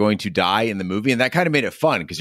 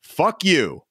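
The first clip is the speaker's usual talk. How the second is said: deadpan stiff suit; 7 semitones higher than usual. Retold or imitated. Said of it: "looks straight in the camera and says".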